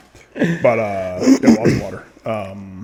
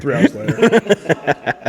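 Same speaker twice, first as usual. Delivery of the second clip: very slow, raised-pitch narrator imitation; SpongeBob time card; 4 semitones higher, much slower than usual. Host joking about fixing it after show, drawn-out narrator. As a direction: draw out each word like a cartoon time card. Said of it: "I'll put the SpongeBob".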